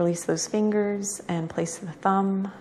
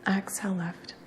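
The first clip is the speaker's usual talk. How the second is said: soft, unhurried yoga-teacher cues; guided breathing meditation; 10 dB quieter than usual. Real, not acted. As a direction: guide each breath quietly, with long pauses between cues.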